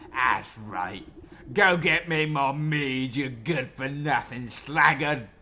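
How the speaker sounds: slow, loud scornful brute; bear voice. Acted. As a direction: bark it slowly and loud. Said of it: "I got the voice for the bear".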